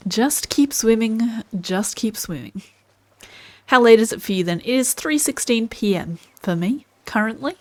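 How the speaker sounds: usual speaking voice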